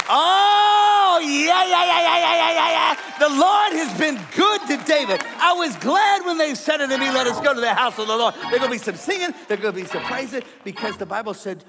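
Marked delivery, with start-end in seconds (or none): 0.1-8.3 loud, high, jubilant yeah yeah yeah; blessed believer shouting for joy to the congregation; shouting praise. Acted. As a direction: burst out praising the Lord at full force. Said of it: "then you can't help but say".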